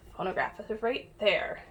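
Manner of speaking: usual speaking voice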